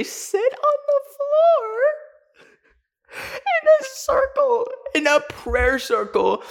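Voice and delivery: high, squeaky laugh-through words; laughing while telling. Real, not acted. Telling the absurd prayer circle, laughing through the words. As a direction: crack up mid-sentence, slowly.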